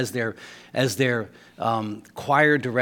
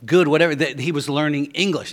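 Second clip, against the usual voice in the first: usual speaking voice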